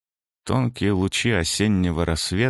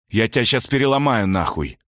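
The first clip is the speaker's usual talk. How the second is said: angry growl, louder; growled threat; 5 semitones higher, 3 dB louder than usual. A narrator's reading. Man threatening the vandal at the fence, angry growl. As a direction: threaten him, snarling. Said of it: "прорычал я".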